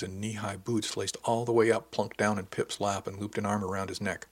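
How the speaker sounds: ordinary delivery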